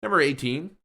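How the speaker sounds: normal talking voice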